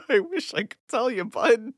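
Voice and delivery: high squeaky laughing voice. Real, not acted.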